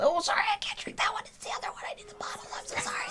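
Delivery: whispering an apology off to the side; whispered aside. Real, not acted. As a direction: murmur it away from the mic. Aside off the mic.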